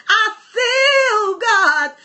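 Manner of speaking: drawn-out, near-sung cry of praise; slow, swelling preacher's proclamation; fervent declaration. Real, not acted. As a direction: stretch each word out long and fervently. Preacher overcome in worship.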